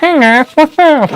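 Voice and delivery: high, slow put-on voice; mock nasal self-impression; nasally impression of himself. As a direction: squeeze it up high and drag it out. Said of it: "that's me all the time".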